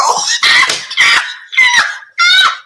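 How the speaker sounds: high shrieking burst of laughter. Real, not acted. Host shrieking with laughter at cohost's joke. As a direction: scream with laughter. Hosts cracking up again.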